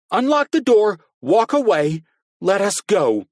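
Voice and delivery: high, loud frantic screaming; high loud desperate shout at the captor; exploding shout. A narrator's reading. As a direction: scream it at the hooded figure, desperate. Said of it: "Carrie seethed and exploded".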